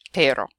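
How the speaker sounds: "pero" said PEH-ro, one tap; tapped Spanish R. Shown as a demonstration. Teacher showing the drill's result, "pero" with tap. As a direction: land on a clean tapped "pero". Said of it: "turn it into that word".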